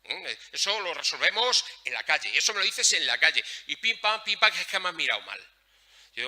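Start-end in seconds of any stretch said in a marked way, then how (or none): 0.5-5.4 loud, high-pitched street bravado; macho tough kid. Acted. Speaker mimics macho teens settling things in the street, loud bravado. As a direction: act out a lad squaring up for a fight. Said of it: "muy machos, porque tenemos una virilidad malentendida".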